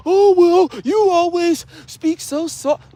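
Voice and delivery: high, slow mocking voice of surprised people. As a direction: put on a high, prissy, drawn-out voice. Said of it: "They be like".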